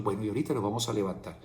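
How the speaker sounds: God murmuring gently into the ear, brisk and hushed; a father's quick soft murmur close to the ear; spoken into the ear. A narrator's reading. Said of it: "le dice al oído".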